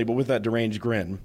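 ordinary delivery

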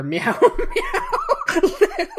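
breaking into laughter mid-name; drawn-out giggling through the name; laughing. Real, not acted. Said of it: "Sorry, I couldn't do that one".

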